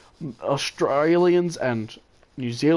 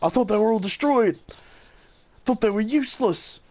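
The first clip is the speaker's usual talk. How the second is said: mock indignation; 7 semitones higher than usual. American player complaining about Russian tanks, mock incredulous. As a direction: mimic an outraged know-it-all. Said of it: "It was like".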